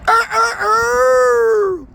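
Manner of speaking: a long, high, loud crowing rooster call. Retold or imitated. Imitating his rooster crowing for the viewers, a long high crow. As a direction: stretch it slowly into a cock-a-doodle crow. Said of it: "if my cock is crowing".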